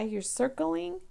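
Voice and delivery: normal talking voice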